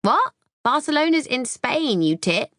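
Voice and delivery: shocked scoff, high-pitched; indignant disbelief. Acted. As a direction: correct her, outraged. Sister corrects with scorn.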